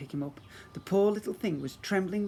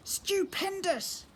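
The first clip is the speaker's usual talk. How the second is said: drawn-out high exclamation; much slower than usual. Acted. Calamitous cheers.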